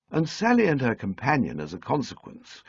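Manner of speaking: ordinary delivery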